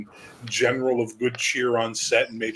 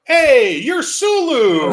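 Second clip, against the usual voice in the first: high, loud, drawn-out outburst; shocked shout; 9 dB louder, much slower than usual. Retold or imitated. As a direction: yell it in total surprise. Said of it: "We'd be like".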